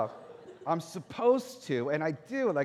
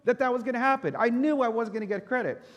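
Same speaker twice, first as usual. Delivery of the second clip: frustrated outburst; 8 semitones higher, 5 dB louder than usual. Retold or imitated. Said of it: "And I was like".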